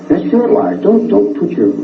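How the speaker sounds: high-pitched, insistent brush-off; retold rebuke. Retold rebuke of confessed killer.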